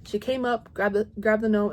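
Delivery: normal talking voice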